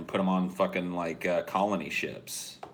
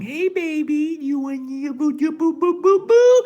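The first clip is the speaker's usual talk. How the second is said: put-on very high, loud cooing baby talk, rising to a squeal; mom baby talk; 12 dB louder than usual. Video mom cooing to her baby in the sarcophagus. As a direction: gush at the baby like a doting mom. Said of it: "life-sized picture of mom going".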